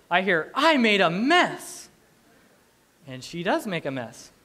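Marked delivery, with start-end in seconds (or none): 0.5-1.9 put-on high, loud, drawn-out toddler announcement; imitated toddler. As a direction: proclaim it like a proud small child. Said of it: "instead of her clapping going, "I did it".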